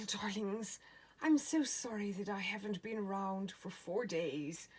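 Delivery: ordinary talk, nothing marked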